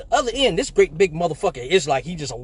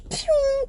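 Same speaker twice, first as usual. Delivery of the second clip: a cartoon Road Runner "pew" as he takes off; high comic whoosh; mock zoom-off noise. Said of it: "So I'm like".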